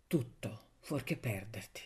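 breathed, voiceless and soft; a hushed fear of losing her; whisper. A narrator's reading. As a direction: let it fall to a whisper. Lover's plea, trailing off.

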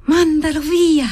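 high, slow, feverish demand; a dying girl angrily demanding; insistent, angry. A narrator's reading. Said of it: "insistendo, arrabbiandosi".

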